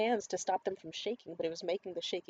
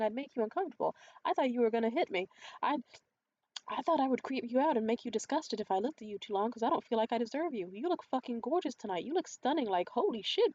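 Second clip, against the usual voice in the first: raised, louder earnest outburst, imitated; snapped, not angrily; 5 semitones higher, 5 dB louder than usual. Date insisting he finds her gorgeous, imitated snapping without anger. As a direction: blurt out a confession in mimicked urgency. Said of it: "The way this man snapped".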